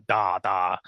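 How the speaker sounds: low, offhand mock-German yes; lazy German-accented audition answer. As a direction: toss off a token German accent. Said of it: "can you do a German accent?".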